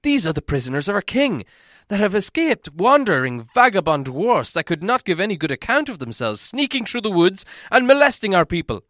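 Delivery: high, loud accusation; accusing elves. A narrator's reading. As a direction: elves denounce the escaped prisoners. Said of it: "they cried".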